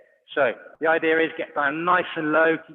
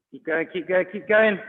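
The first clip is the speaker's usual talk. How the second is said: rising, louder urging; 5 semitones higher, 5 dB louder than usual. Real, not acted. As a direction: push them to keep going. Coach urging during sprint.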